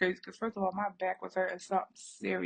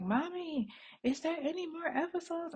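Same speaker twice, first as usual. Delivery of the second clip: put-on much higher little-girl voice; daughter asking; 9 semitones higher, 3 dB quieter than usual. Mom imitating her daughter hooked on the show, high childlike. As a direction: play the eager kid asking for more. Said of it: "and was like".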